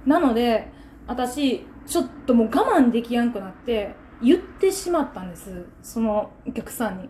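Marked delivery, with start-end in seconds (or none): none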